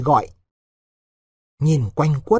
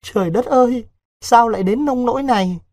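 mildly tearful, higher-pitched wail; distraught sobbing; 7 semitones higher than usual. A narrator's reading. Girl hearing her lover is leaving for Laos, mildly distraught. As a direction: lament in a high voice, lightly acted grief. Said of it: "bưng mặt khóc nức nở".

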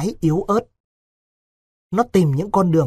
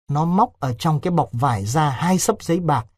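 ordinary talk, nothing marked

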